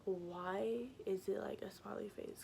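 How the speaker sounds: hushed, stunned whisper; a whispered what-is-this; whispered disbelief. Retold or imitated. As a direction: breathe it out in disbelief. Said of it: "I'm like".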